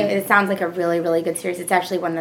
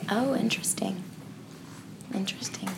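hushed, impressed whisper; 9 dB quieter than usual. Real, not acted. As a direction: breathe it out, intrigued. Reacting to the plot summary.

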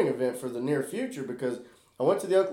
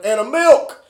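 higher and louder, insisting on the milk; mock shout; 8 semitones higher, 10 dB louder, slower than usual. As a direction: yell the forgotten item back at her. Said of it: "and Still's like".

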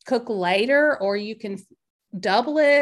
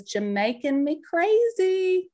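drawn-out brand name read; 6 semitones higher than usual. Retold or imitated. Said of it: "It says".

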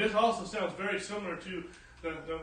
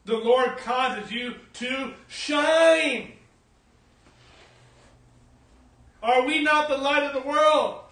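loud, raised sermon voice with stretched key words; full-force preaching; 8 semitones higher, 11 dB louder than usual. Real, not acted. Preacher telling believers they are the light, loud fervent preaching. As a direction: ring it out to the whole church.

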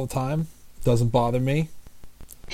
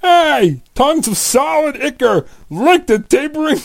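high, loud whiny New York voice; Ray Romano impression; 12 semitones higher, 6 dB louder than usual. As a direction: whine it like a Queens dad. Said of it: "I'll do Ray Romano".

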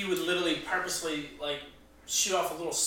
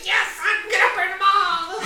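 manic laughing; 10 dB louder than usual. Acted. Pyromaniac character cackles.

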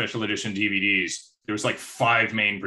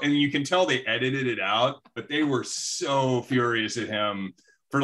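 ordinary delivery

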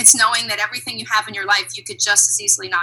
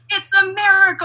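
high-pitched incredulous exclamation; imitated comedian's yell; 10 semitones higher, 4 dB louder than usual. Comedian scolding airplane complainers, mock yelling. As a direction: cry it out like an exasperated comic. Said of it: "he yells into the microphone".

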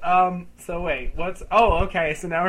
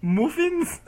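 put-on much higher British-accented echo; mocking British accent; 12 semitones higher than usual. Customer echoing the waiter's muffins, in mocking British accent. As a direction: parrot it back in a mock British accent. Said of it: "Are you mocking my accent".

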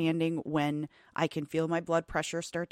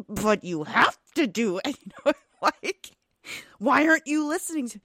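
exasperated parent, higher and louder; mock-frustrated raised voice, pitched up; 7 semitones higher, 5 dB louder than usual. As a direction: imitate a mom losing patience with a child. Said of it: "me all of a sudden raising my voice".

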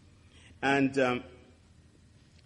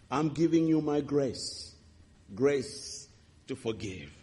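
tender voice of God; slower than usual. Retold or imitated. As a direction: hand over the gift gently. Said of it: "I could hear God saying to me".

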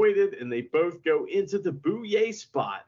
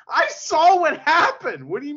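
mock referee yell; 11 semitones higher, 7 dB louder than usual. Referee scolding Hiromu, a hammed-up loud rebuke. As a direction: ham up the angry referee. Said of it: "He's like".

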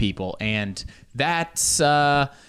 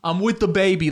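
proud pre-boarding claim; 8 semitones higher, 3 dB louder than usual. Retold or imitated. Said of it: "I'm like".

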